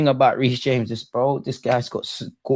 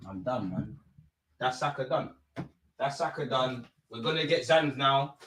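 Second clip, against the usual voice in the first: deflated; 12 dB quieter than usual. Real, not acted. Despair after injury.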